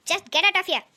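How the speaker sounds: loud furious order to leave; angry shout. Acted. Husband shouting the rude neighbour out of his home, furious. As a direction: bark her out of the house.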